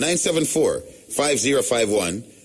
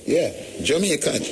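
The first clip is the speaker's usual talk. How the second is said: ordinary talk, nothing marked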